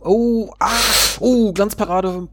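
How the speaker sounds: high-pitched gasping match commentary; real jolt of excitement, pitched high; startled excitement. Real, not acted. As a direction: react live to a sudden save, voice leaping up. Live reaction to a near goal.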